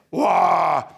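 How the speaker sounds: short mock yell of jumping in; mock shout. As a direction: blurt a loud playful cry. Parent jumping in.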